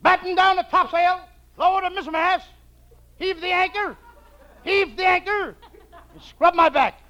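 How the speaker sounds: high-pitched calling of ship's orders; mock sailor commands. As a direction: call out orders like a pretend skipper. Shows off mock sailing commands.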